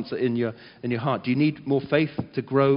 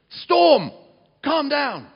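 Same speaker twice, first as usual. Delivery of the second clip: high, loud command to the storm; commanding shout; 12 semitones higher, 10 dB louder, much slower than usual. Pretend. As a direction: bellow it at the storm with full authority.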